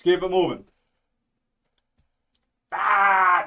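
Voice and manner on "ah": loud, drawn-out "aah"; exasperated outburst; frustrated yell. Real, not acted. Frustrated with stubborn highlights.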